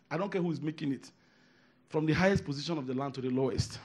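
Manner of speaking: ordinary talk, nothing marked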